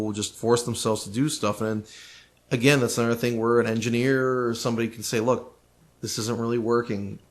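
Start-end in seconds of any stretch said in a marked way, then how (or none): none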